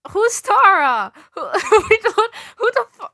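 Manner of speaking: high, loud disbelieving squawk; cracking up in disbelief; incredulous laughing outburst. Real, not acted. Streamer reacting to the author's note, incredulous and laughing.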